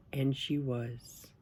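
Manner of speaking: usual speaking voice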